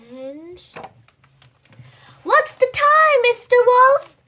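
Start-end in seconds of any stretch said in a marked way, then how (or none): 2.2-4.2 much higher, loud yell; spring the surprise; surprise party shout. Acted.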